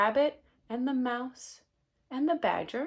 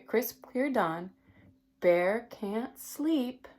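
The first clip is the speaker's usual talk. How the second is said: put-on reading to children; 3 semitones lower, 4 dB louder than usual. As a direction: savour each line for the little ones. Reads picture book aloud.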